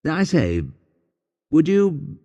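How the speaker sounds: a hint of a bashful Englishman popping the question; shy English proposal. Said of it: "he says".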